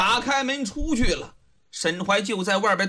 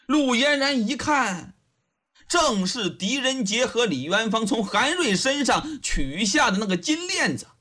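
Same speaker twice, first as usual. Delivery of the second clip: plain reading voice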